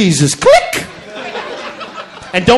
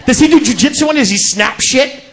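ordinary delivery

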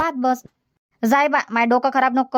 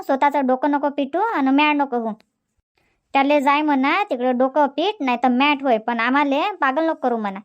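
ordinary talk

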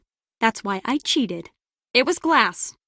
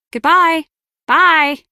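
pointed, raised goodbye; loud, higher-pitched send-off; loud dismissal; 5 semitones higher, 8 dB louder, slower than usual. Acted. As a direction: send them away loudly. Acted dismissal of someone.

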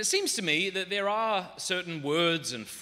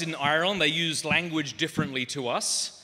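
ordinary talk

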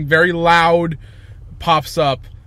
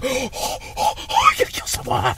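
high, gasping, overexcited mock voice; Bye Bye Man; 9 semitones higher than usual. Bored ghost finally gets thought of, giddy gasping excitement. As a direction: squeal it breathlessly, quick and giddy. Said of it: "You know how excited he gets".

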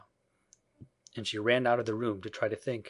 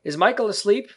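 put-on high, loud boyish question; boy's careless question; 10 semitones higher, 9 dB louder than usual. A narrator's reading. Peter glances at the bed, loud high boy voice. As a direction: toss it out loudly as a boy.

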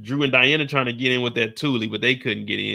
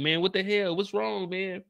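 higher, softer bewildered man; mock puzzled complaint, pitched up and trailing softer; baffled; 7 semitones higher, 5 dB quieter than usual. Kane baffled by crying girlfriend.

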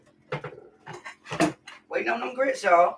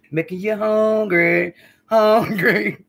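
drawn-out, teasing "hungry" with a laugh breaking in; teasing food tease; 3 semitones higher, 7 dB louder, much slower than usual. Real, not acted. Cooking vlogger teasing her viewers with the food, drawn-out laughing tease. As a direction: tease the viewers, stretch it out then crack up.

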